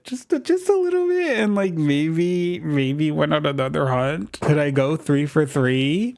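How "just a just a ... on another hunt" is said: teasing. Real, not acted. Teasing viewers about another hunt, coy. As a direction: tempt the viewers mischievously.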